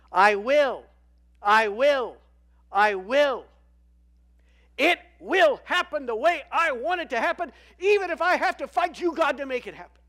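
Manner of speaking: high, loud, defiant rant; defiant shout. Acted. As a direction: rage against God, shouting. Satan voiced defiantly.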